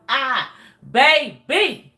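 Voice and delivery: put-on long, loud, rising "ah, baby" with a laugh in it; triumphant drawn-out baby. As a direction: savour the word like a victory. Celebrating walking away from him.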